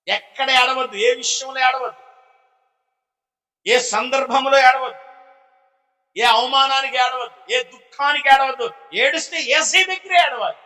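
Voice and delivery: high, insistent pulpit exhortation; forceful preacher's refrain, held high; impassioned preaching. Real, not acted.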